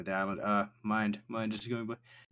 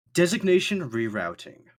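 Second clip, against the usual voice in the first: higher, louder robotic announcement; automated voice; 6 semitones higher, 7 dB louder than usual. Acted. Snail relays call to player, automated announcement voice. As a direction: read it like a machine. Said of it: "what sounds like an automated voice say".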